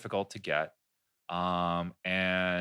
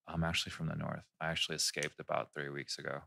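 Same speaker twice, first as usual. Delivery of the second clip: low, soft, solemn confession; deadpan tall tale; 7 semitones lower, 9 dB quieter than usual. Retold or imitated. Feeding strangers a fake escape story, solemn. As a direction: confess it gravely, low and hushed.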